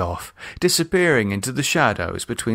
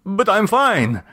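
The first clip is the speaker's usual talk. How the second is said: alarmed insistence; 9 semitones higher than usual. Acted. Jose insists, alarmed, to doctor.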